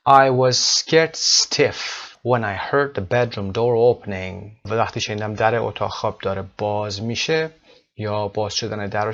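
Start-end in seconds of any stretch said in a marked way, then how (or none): 0.1-4.7 very slow, each word drawn out; slow model reading. Shown as a demonstration. Teacher modelling a sentence for students, very slow. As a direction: read it out slowly for learners.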